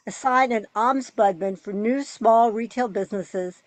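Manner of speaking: ordinary delivery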